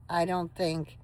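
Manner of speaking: usual speaking voice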